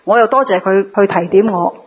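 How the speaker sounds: ordinary talk, nothing marked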